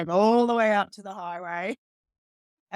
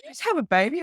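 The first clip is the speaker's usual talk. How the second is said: higher, incredulous question; surprised midwife; 11 semitones higher, 5 dB louder, faster than usual. Retold or imitated. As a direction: ask it in disbelief. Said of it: "she goes".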